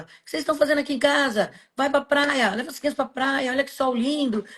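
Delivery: lightly voiced aunt sending family to the beach; cheerful aunt shooing. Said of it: "Ela mesma falava".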